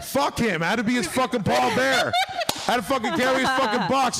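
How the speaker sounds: high-pitched, laughing indignation; worked-up comic rant. Real, not acted. Comic telling friends he carried his dying uncle's box, worked up.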